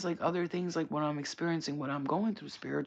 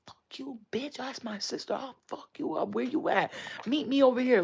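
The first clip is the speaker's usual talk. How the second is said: high-pitched mock fighting talk, building louder; imitated threatening sister; 9 semitones higher, 4 dB louder than usual. Imitating sister threatening online commenters, dead-serious angry threat. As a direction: start small and high, build to a loud challenge. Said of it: "she was dead serious".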